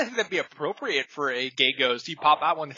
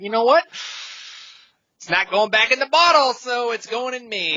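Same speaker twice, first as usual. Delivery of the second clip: raised, emphatic self-quote; loud mock declaration; 7 semitones higher, 6 dB louder than usual. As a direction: announce it boldly. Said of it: "and I went".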